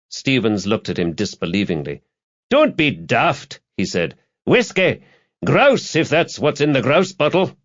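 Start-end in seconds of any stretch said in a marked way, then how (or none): 2.5-3.6 higher, drawn-out dismissal; scornful retort. A narrator's reading. Stevens rebuffs the barman's juice offer, scornful. 4.4-7.6 high, punchy, testy order; emphatic drink order. A narrator's reading. Stevens corrects the barman about his drink, emphatic.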